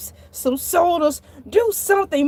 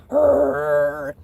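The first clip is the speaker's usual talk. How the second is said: dog growl. Retold or imitated. Shark retelling the sound he heard behind him, imitated growl. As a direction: growl out a long rolling "arr". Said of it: "I'm hearing a".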